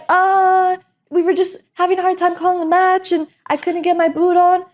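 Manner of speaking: flustered excuse. Retold or imitated. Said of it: "I'm like".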